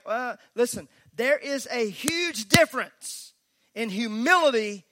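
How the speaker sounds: fired-up sermon voice; full-force preaching. Acted. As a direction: press the point home to the church.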